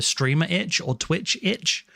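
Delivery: ordinary talk, nothing marked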